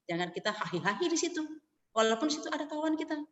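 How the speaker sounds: ordinary delivery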